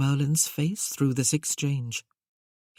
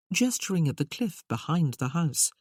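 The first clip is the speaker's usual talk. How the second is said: ordinary delivery, nothing marked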